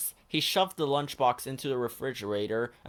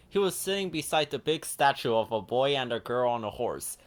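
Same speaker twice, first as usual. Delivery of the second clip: plain reading voice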